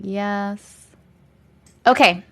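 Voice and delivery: ordinary talk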